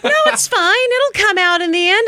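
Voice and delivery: hint of the carefree friend; unbothered friend. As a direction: toss it off lightly. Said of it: "And she's like".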